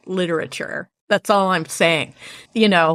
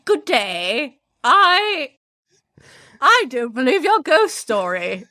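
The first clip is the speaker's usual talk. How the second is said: her best British voice, on purpose; most British accent; 6 semitones higher, slower than usual. Retold or imitated. Showing her friend her most British accent. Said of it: "That's, that's my most British".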